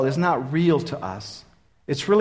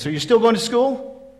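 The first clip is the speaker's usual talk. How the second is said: mother asking; 7 semitones higher than usual. Retold or imitated. Mother voiced in story.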